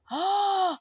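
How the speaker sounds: sharp high gasp of shock. A narrator's reading. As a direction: gasp at the sight of the body. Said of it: "The villagers gasped".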